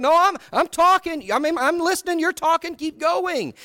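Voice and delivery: high-pitched, flustered mock insistence; upset protesting. Listener denying he is not listening, upset re-enactment. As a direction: insist you are listening while clearly upset. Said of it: "And I'm just upset".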